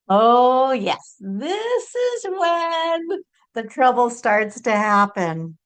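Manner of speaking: high, drawn-out, swooping emphasis; dramatic knowing warning. Real, not acted. Channeler warning listeners when trouble starts, theatrical knowing tone. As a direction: stretch it out high, like a playful alarm.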